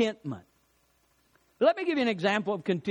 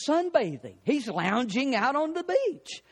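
usual speaking voice

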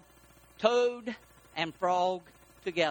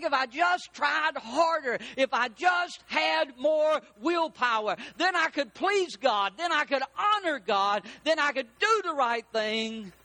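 higher, slow and emphatic; earnest striving; 5 semitones higher than usual. Acted. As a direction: plead your resolve, punching each phrase. Acts out believer's striving thoughts.

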